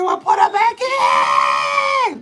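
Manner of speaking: high, slow drawn-out shout; hammed-up shout; mock shout. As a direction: yell it back in exasperation. Retorts to mama mid-labor.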